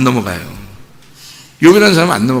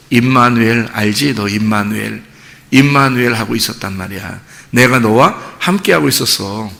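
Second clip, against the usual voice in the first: low, gentle reassurance; hushed, consoling voice of God; tender consolation; 5 semitones lower, 4 dB quieter than usual. Acted. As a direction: comfort them softly, as a loving father would. God consoling Job after his suffering.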